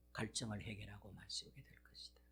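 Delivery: whispered promise. Acted. Ending God's promise softly.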